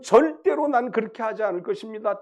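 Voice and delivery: confident vow. Retold or imitated. Peter answering Jesus at the last supper, confident vow. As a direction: swear it firmly, as a retold vow. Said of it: "장담을 했습니다".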